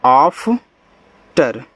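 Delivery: "आफ्टर" drawn out in two separated parts, आफ्... टर; slow syllable-by-syllable demo. Shown as a demonstration. Teacher showing students how to read afternoon, "आफ्टर" slowly split in two. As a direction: say "आफ्टर" very slowly, pausing before टर. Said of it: "ऐसे".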